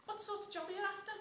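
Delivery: a hint of a job centre adviser; job centre woman. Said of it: "And the woman goes".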